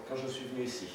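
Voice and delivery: ordinary delivery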